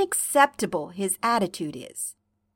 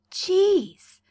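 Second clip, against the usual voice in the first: a breathy unvoiced Jeez; fond exasperated whisper; whispered sigh; 10 semitones higher than usual. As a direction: exhale it under your breath. John reassures sick Karkat.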